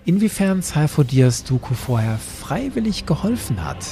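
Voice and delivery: ordinary delivery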